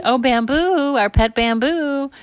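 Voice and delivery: high, drawn-out cooing; fawning over pet. Acted. Family naming their panda, cooing. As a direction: coo adoringly over the baby animal.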